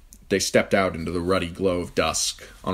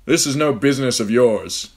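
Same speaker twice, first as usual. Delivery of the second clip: angry warning; 6 semitones higher, 8 dB louder than usual. A narrator's reading. Ned threatening with dagger.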